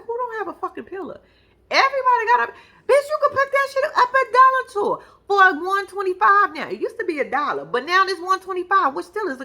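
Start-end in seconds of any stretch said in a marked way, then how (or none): 0.1-6.7 loud, high incredulous rant; shouting at the couple on TV about a pillow; exasperated yell. Real, not acted. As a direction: yell it in disbelief at the screen. Yelling at TV couple over pillow.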